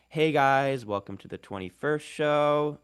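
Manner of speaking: mock Valley Girl up talk, stretched out; lifestyle influencer accent. Linguist imitating a lifestyle influencer greeting, lightly mocking up talk. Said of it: "There's a lifestyle influencer accent like".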